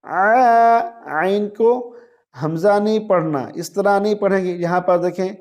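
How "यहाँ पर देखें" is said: ordinary delivery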